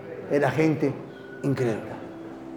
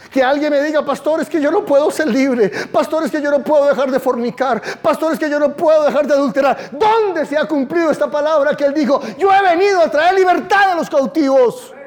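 full-force preaching; 8 semitones higher than usual. Acted. Pastor crying out to his church, full-force preaching. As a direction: preach it out, pleading and insistent.

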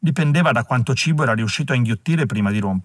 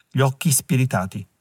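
usual speaking voice

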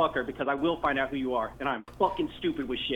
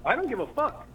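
high-pitched angry outburst; real anger; 8 semitones higher than usual. Real, not acted. Threatened prank victim dismissing any arrest, angry and high. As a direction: throw it out angrily, voice jumping up. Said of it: "Why are you getting so angry?".

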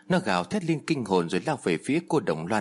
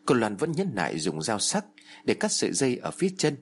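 ordinary delivery

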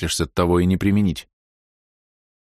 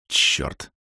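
breathy whispered swear; whispered curse. Acted. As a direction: hiss the curse out under your breath. Muttered curse, door opening.

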